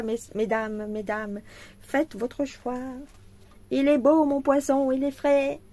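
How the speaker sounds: loud, high street-vendor calling; market hawker. Pretend. Selling her canvas to viewers, she calls out like a fishmonger. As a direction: hawk your wares to the ladies.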